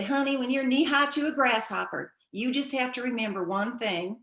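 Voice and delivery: put-on grandmother's folksy saying in a Southern accent. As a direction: imitate a Southern grandmother, pitch lifted. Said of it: "we all talk like this with a Southern accent".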